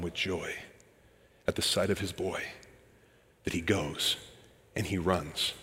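soft, hushed retelling of the father's joy; hushed tender storytelling. Acted. Preacher softly retelling the father running to his son, hushed. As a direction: draw them in quietly.

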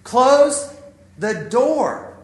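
loud, high parental outburst; each word punched out with pauses; losing it. Retold or imitated. Dad losing it at son over the door, exasperated shout. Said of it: "I finally kinda lose it".

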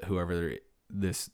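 ordinary delivery

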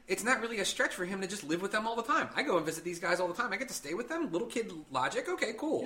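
lightly voiced little kid, pleased.

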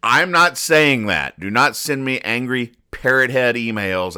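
ordinary delivery

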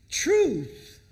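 Truth rung out high and loud; proclaiming. Real, not acted. Preacher declaring truth to the congregation, loud. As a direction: shout the word Truth from the pulpit.

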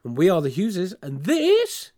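drawn-out podcast intro; announcer voice. Real, not acted. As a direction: announce the show grandly.

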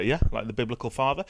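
usual speaking voice

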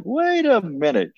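a playful hint of a French accent. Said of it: "with a little French accent".

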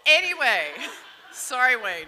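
laughing through an apology. Real, not acted. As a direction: say it while still laughing.

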